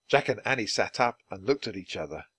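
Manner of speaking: ordinary delivery, nothing marked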